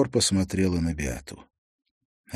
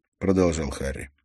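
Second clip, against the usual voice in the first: ordinary delivery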